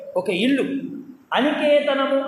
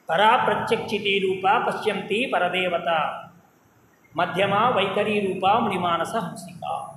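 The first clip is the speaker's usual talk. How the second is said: verse recitation; 5 semitones lower than usual. Acted. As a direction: recite it like a hymn. Recites Lalita Sahasranama verse.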